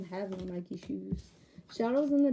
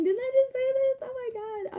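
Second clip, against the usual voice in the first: surprised squeal. Real, not acted. To the viewers, realising she repeated herself, squealing high. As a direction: catch yourself repeating and squeal.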